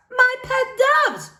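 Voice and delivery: very high, loud, slow and proud; proud librarian voice. Acted. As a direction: show off the doves grandly. Rosalita answers the girl.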